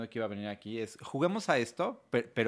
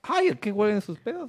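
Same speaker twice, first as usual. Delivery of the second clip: put-on six-year-old boy; 8 semitones higher, 5 dB louder than usual. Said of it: "¿estás seguro que tienes seis años".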